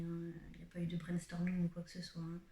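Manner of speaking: quick confiding whisper; whispered aside. Real, not acted. Vlogger whispering that there was no brainstorming. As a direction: drop to a whisper for the joke.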